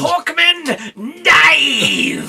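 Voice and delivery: high, loud, laughing bellow; Brian Blessed shout. Retold or imitated. Host riffing on Brian Blessed, loud laughing bellow. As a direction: roar it like a hammy actor.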